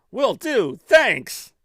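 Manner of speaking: loud, high-pitched acted thanks; hearty call-out. Hero calls cheerful farewell in game.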